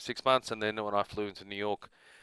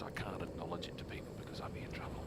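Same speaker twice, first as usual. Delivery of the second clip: conspiratorial whisper; 3 semitones lower, 13 dB quieter than usual. Acted. Confessing being a secret Warcrafter.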